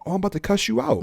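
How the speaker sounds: high, soft, incredulous mock threat; retold threat. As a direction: threaten a cussing-out in a high, hushed voice. Acted threat to his father.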